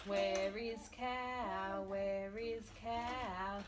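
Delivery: slow sing-song hiding game; reading to a toddler. Acted. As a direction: draw it out playfully for a child. Hide and seek with puppets.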